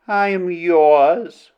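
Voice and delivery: ordinary delivery, nothing marked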